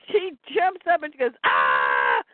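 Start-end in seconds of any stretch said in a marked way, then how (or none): 1.4-2.2 battle cry. Acted. Barta attacks with battle cry.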